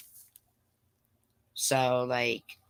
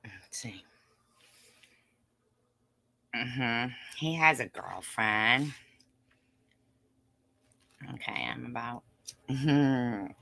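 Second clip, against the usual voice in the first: eating crunchy fried chicken; 4 dB quieter than usual. Real, not acted. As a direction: mouth full of chicken. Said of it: "I'm eating chicken".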